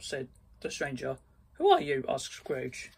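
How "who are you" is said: much higher startled question. Acted. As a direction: ask it with a jolt of fright. Scrooge woken by stranger.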